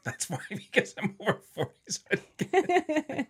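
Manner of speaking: high-pitched, helpless laughter through the words; talking through real giggles; laughing. Real, not acted. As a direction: crack up while trying to explain yourself. Cracking up over confusing sentence.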